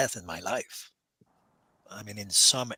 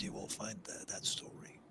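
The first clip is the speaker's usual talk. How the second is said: whispered aside; 3 semitones lower, 17 dB quieter than usual. Real, not acted. Trailing aside about Google.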